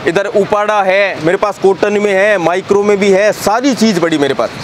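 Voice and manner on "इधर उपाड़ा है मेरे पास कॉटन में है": usual speaking voice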